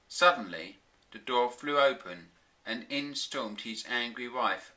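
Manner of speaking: usual speaking voice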